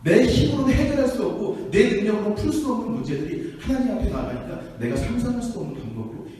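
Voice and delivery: rousing pulpit declaration; impassioned preaching. Real, not acted. Preacher builds to climax.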